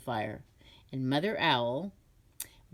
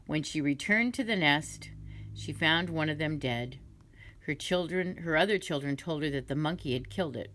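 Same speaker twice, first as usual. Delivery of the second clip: ordinary delivery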